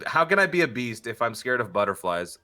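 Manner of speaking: ordinary talk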